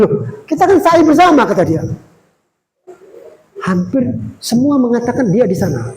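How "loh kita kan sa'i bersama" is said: high, louder loh of astonishment; insistent surprise. Acted. Friend insists they did sa'i together, high astonished. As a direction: protest it, voice leaping up.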